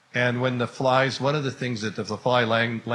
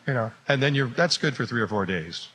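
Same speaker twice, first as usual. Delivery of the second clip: ordinary talk, nothing marked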